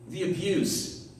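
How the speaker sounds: ordinary talk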